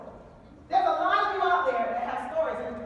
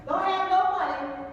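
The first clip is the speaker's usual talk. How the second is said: normal talking voice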